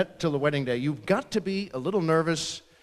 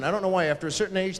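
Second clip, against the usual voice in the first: ordinary talk, nothing marked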